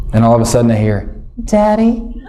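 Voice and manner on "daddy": put-on high, small child's voice; a sleepy little daughter calling; high child whimper; little girl voice.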